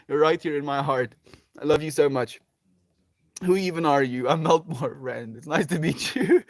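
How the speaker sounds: ordinary talk, nothing marked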